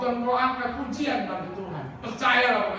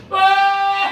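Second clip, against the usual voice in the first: high, long mock wail of mourning; imitated loud wail; 11 semitones higher, 12 dB louder than usual. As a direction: wail loudly like a mourner, acted out. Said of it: "menangis dengan keras". (tiruan ratapan keras)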